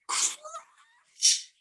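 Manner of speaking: very high squealed crash; dramatic crash. A narrator's reading. Storyteller acting out the beanstalk falling, very high.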